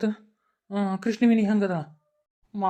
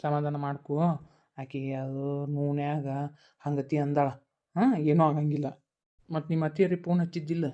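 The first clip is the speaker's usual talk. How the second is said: low, much softer soothing voice; hushed comfort; 8 semitones lower, 5 dB quieter than usual. Acted. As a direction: quietly settle her fears. Uncle calms crying niece.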